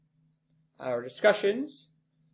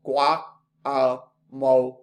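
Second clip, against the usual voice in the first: "guacamole" in four slow, loud beats; syllable by syllable; 2 semitones lower, 5 dB louder, much slower than usual. Shown as a demonstration. Teacher counting syllables for class, "guacamole" pulled apart slowly. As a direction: break up "guacamole" as gua - ca - mo - le, pausing between. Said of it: "four syllables".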